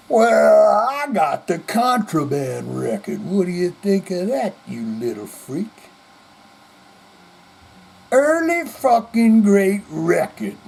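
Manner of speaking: put-on sloppy, slurred drunk rocker boasting; drunk rock star. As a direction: slur it like a drunk musician showing off. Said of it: "He was drunk".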